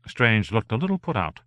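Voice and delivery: ordinary delivery, nothing marked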